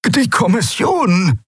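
high, slow, fearful croak; anxious croak. A narrator's reading. Rubandra anxious about the commission, croaking high. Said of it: "krächzte Rubandra ängstlich".

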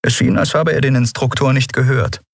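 ordinary delivery

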